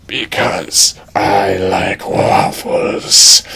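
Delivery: slow creepy dragon purr. Acted. The dragon wooing Edge, slow creepy voice. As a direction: coax him like a sly beast.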